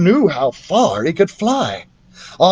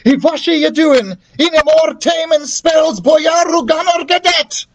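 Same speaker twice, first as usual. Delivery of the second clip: cried spell; 13 semitones higher than usual. Acted. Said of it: "as we cried".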